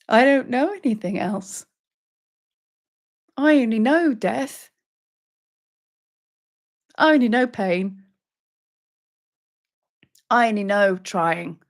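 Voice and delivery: anguished confession. Acted. Seeker answers Mother Sekhmet, mildly anguished confession. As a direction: separate admissions with long pauses between.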